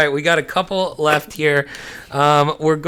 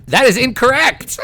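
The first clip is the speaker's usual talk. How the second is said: high-pitched gleeful game-show ruling; excited reveal; 8 semitones higher, 3 dB louder than usual. Real, not acted. Host rules on a contestant's answer, excited reveal.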